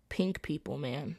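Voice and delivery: breathy, near-whispered aside under a sigh; sighing mutter. Real, not acted. To the camera about the girl who skipped handwashing, a sighing mutter. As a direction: let it out on a tired sigh.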